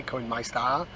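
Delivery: self-mocking quick North Wales accent "Coinmeister" with an upward lilt. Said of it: "There's the impression".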